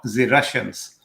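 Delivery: a light, badly faked Russian accent; terrible Russian accent. Host introducing the Russians topic, bad mock Russian accent. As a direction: attempt a slight Russian accent and fail. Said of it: "that was a terrible example of a Russian accent".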